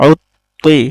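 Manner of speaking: উত্তি with the t doubled and held; doubled consonant. Shown as a demonstration. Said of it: "সেই হরফটি তার ডান দিক হরকতের সঙ্গে আগে উচ্চারণ". (তাজদিদে তা দুইবার উচ্চারণ)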